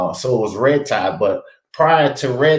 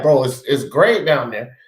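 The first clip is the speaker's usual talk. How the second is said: ordinary talk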